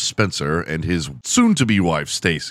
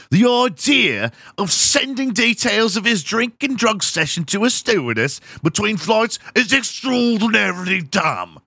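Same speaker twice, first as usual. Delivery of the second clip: higher mock British accent building to a shout; 10 semitones higher than usual. Comedian keeps voicing the airline source in a British accent. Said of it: "I need to do a British accent".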